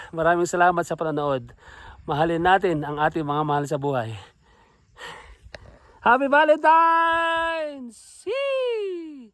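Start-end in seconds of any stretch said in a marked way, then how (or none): 6.0-9.3 high, slow, stretched-out greeting; drawn-out cheer. Real, not acted. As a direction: sing it out happily. Greeting viewers at summit. (masayang pahabang bati)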